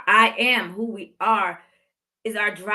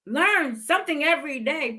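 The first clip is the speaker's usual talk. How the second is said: ordinary talk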